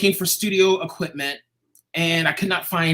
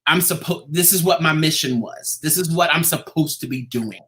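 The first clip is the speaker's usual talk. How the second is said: usual speaking voice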